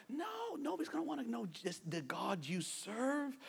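ordinary talk, nothing marked

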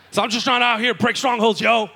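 put-on high, loud, earnest young convert; playing up his own early zeal; eager new believer. Young convert answering friends at the party, loud and earnest.

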